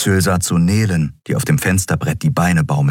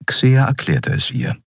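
ordinary delivery, nothing marked

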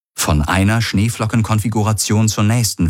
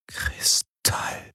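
hushed, voiceless whisper; barely-there whispered word; 10 dB quieter than usual. A narrator's reading. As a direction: whisper it slowly in awe. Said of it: "flüsterte Garland".

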